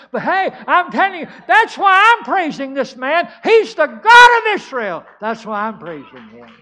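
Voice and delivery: high, exultant shout building to a peak; loud revival-preacher cry of praise; triumphant proclamation. Acted. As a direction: testify at full force, climbing to a yell. Climax of second acted testimony.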